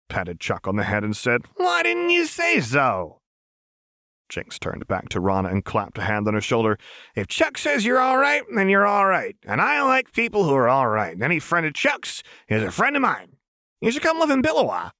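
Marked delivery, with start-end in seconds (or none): 1.6-3.2 very high, stretched-out singsong; sudden gushing cheer; Jinx, overjoyed. A narrator's reading. Jinx switches to glee. 7.2-14.9 very high, effusive gush; pushy, squeaky enthusiasm; Jinx, manic welcome. A narrator's reading. Jinx gushes welcome at Rana.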